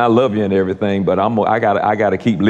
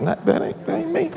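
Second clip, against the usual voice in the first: high squeaky denial; 6 dB quieter than usual. Acted. Preacher refusing blame to congregation, high squeak. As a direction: voice shoots up, thin and soft.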